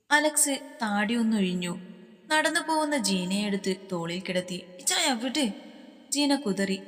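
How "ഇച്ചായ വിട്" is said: high, pleading female voice; a young woman wriggling free; protesting girl. Acted. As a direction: squirm and beg him to let go. Jeena struggling on his shoulder.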